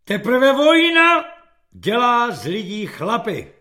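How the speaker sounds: loud, high, announcing title; loud heading announcement; chapter title read. Acted.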